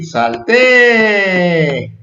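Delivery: "salté" exclaimed with a long drawn "té"; exclamation. Shown as a demonstration. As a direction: demonstrate an exclamation mark, stretching "salté". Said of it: "Entonces así".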